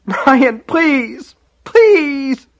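very high, tearful begging; wailed, desperate plea; terrified pleading. A narrator's reading. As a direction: beg as a crying, frightened child. Terrified boy begs brother with rifle.

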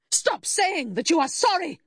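high-pitched furious outburst; angry shout. A narrator's reading. Enraged mother rejecting sympathy, shouting. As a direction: explode sharply, pitched very high. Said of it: "she shouted".

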